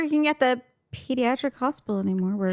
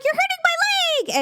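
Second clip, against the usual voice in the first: put-on much higher whiny child voice. Mom imitating daughter whining about her leg. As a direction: complain like a little girl being pinched. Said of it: "And she's like".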